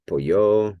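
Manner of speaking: teacher's slow pollo, weight on the ending; stress on last syllable. Shown as a demonstration. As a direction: lean hard and long on the end of pollo. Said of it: "it's always on that last syllable".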